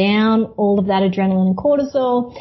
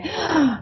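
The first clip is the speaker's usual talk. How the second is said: quick big gasp of air; sharp gasp in; 7 semitones higher than usual. Shown as a demonstration. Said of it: "they go".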